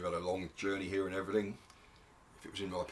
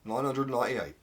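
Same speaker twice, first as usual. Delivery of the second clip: announcing count; 4 semitones higher, 7 dB louder than usual. Acted. Judges counting hairs aloud.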